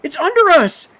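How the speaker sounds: very high, loud panicked scream. A narrator's reading. Kathy warning friends of something beneath, screaming. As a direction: shriek the warning in terror. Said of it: "she screamed".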